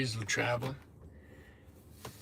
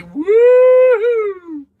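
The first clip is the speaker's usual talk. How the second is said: high, loud whoop of joy; excited cheer; 15 dB louder than usual. Real, not acted. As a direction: let out a big celebratory woo-hoo. Cheering about moving south.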